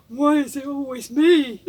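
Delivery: hint of a Brummie moaning soldier; a light Brummie voice with a laugh behind it; Brummie accent. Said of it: "he said it in a Brummy accent".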